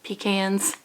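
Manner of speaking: "Pecans" as PEE-cans; PEE-cans pronunciation. Shown as a demonstration. Vlogger repeating the peas-in-a-can way, "Pecans" as PEE-cans. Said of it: "like peas in a can".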